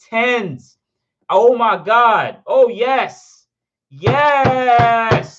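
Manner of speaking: high, loud cries of joy; real excitement. Real, not acted. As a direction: shout it out, overjoyed at big news. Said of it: "I'm freaking excited about this".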